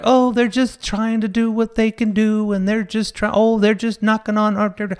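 high, airy mock of gullible neighbours; naive neighbours. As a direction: mimic people who excuse anything. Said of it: "some people are like".

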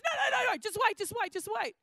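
high, insistent pleading to hold on; frantic stalling. Retold or imitated. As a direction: stop them before the timer starts. Husband stalling the egg timer.

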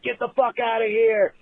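ordinary talk, nothing marked

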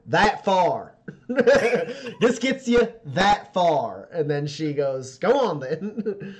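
high, slow, amused put-on voice; laughing woman's voice; wry woman bargaining. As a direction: dole it out with a chuckle. GM voices Michelle setting a price.

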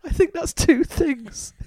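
high, slow and amused. Real, not acted.

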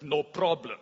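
put-on a lightly menacing Russian accent on no problem; deadpan Russian man, sounding scary. Preacher imitating the Russian man at the airport gate, mock Russian accent. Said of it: "something about the Russian accent".

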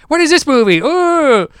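high-pitched, mock excited kid voice; noisy kids. Noisy kids entering the theater.

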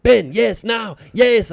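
high, loud urgent push; hiss-free eager shout to go now; urging producer. Retold or imitated. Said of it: "the Argentinian producer".

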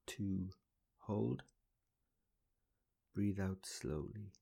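pace the breaths gently, counting slowly. Acted.

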